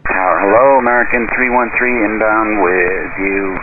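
ordinary talk, nothing marked

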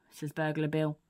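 plain reading voice

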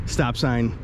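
usual speaking voice

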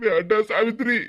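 low, tearful plea through sobs; old man weeping, barely getting the name out; crying old man. Acted. To his wife Savitri while weeping, low and tearful. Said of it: "கொஞ்சம் அழுகையை நிப்பாட்டிட்டு".

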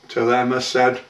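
ordinary delivery

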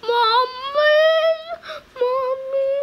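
high, loud screams of mommy; mock scared scream. Gamer shrieking at a jump scare, mock terrified. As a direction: shriek for mommy like a frightened kid.

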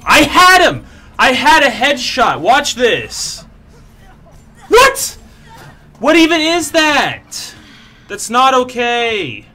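loud, high outraged shouting; real yelling. Real, not acted. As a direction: yell it in disbelief, voice pushed way up. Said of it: "'Cause I'm yelling".

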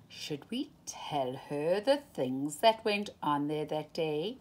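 ordinary delivery, nothing marked

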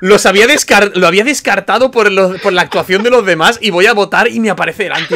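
fast, high and loud rant; excited rant. Real, not acted. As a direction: pour out the frustration in a rush. Ranting about his failed deduction.

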